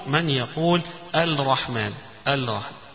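الرحمن with a clear separate lam, al-rahman; lam pronounced. Shown as a demonstration. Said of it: "أظهر المدغم مثل أن يقول". (إظهار اللام في الرحمن)

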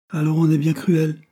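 high, loud indignant cry; a girl crying out against a cruel town; angry outburst. Acted. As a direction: fling it out in a burst of temper. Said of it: "s'écria-t-elle avec emportement".

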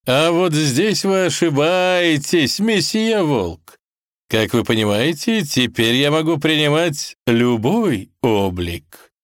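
slow, high theatrical villain; grand, mocking genie showing off; smug genie, gloating. A narrator's reading.

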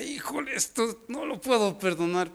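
high, soft mock lament; struggling to forgive. Voices a believer struggling to forgive. (lamento agudo y suave)

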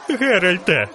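high-pitched cry for a rescuer; narrator's panicked squeal, no extra volume; shrieking. A narrator's reading. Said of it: "верещав жовтець". (панічний вереск високим голосом)

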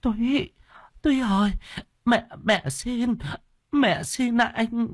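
put-on very high, slow, halting old woman; frail high-pitched plea; pleading old mother. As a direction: beg your son to stop. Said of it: "Bà cụ ma thấy vậy vội can ngăn".

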